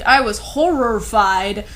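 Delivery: normal talking voice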